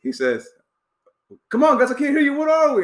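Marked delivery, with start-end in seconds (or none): 1.5-2.8 high, loud coach's rally call, half-laughing; coach hyping team. Retold or imitated. Coach at halftime demanding the team shout back, high loud rally call. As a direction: egg the team on like a fired-up coach demanding an answer.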